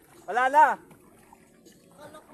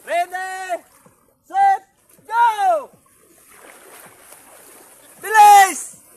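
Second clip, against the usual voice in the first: loud, higher start shout; 5 semitones higher, 13 dB louder, much slower than usual. Real, not acted. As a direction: yell the start of the race. Starting the final race.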